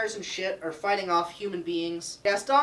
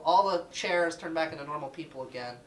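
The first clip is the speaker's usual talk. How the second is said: ordinary delivery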